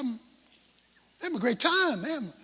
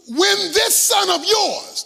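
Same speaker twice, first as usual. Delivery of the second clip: high, loud, slow accusation; indignant shout; 7 semitones higher, 5 dB louder, slower than usual. Acted. Jealous older son shouting at the father about his brother, indignant. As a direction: bellow it slowly at the father.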